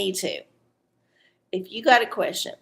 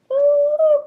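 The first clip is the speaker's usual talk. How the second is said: very high, sustained "ooh"; long high coo of interest; intrigued "ooh"; 7 dB louder than usual. Real, not acted. Host seeing the Bridget card, intrigued high ooh.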